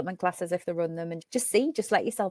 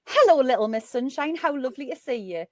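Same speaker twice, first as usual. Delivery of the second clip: higher, louder cheery greeting; retold star's hello, pitched up and lilting; 6 semitones higher, 5 dB louder than usual. Celebrity greeting a young fan at a record signing, imitated high greeting. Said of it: "and [name] went".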